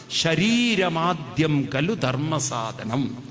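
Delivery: slow, measured Sanskrit verse recitation; quoting a sage. Retold or imitated. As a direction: recite the sage's maxim very slowly, drawing out the syllables. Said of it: "ഋഷി എന്ത് പറഞ്ഞു". (ഋഷിവചനം സാവധാനം നീട്ടിച്ചൊല്ലുന്നു)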